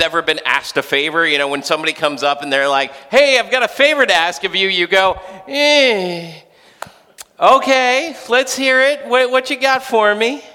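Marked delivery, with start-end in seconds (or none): 3.1-4.7 high, loud, quick request; eager favor-asker. Acted. Someone coming up to ask a favor, high and eager. 5.5-10.4 high, loud, hesitant consent; wary reluctance. Acted. Parent asked for a favor, warily agreeing.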